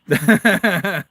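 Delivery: loud burst of laughter; laughing. Real, not acted. Laughing after washing bug down.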